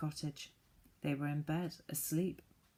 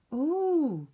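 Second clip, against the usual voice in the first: high, loud excited ooh; 10 semitones higher, 7 dB louder than usual. A narrator's reading. Fanny thrilled by the magic land, a high loud ooh. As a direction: gasp it out with delight.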